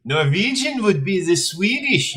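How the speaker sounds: lightly put-on Swedish lilt; Swedish accent. Player explaining accents, in a light Swedish accent. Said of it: "I wasn't speaking a Norwegian accent".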